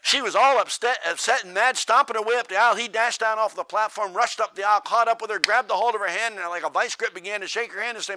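loud, high, driving sermon delivery; full-force preaching. Acted. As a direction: preach it to the back row.

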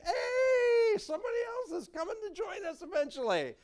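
very high, loud holler of announcement; joyful heavenly shout. Pretend. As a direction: call it out to all of heaven in mock excitement. Acting out heaven announcing a newcomer.

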